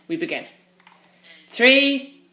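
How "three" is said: shouting the countdown high; loud countdown. Real, not acted. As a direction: call out each number loudly. Calling the countdown.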